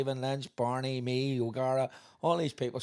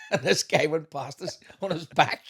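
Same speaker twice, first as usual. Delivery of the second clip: ordinary talk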